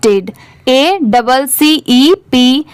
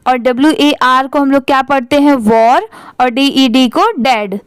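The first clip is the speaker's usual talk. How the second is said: normal talking voice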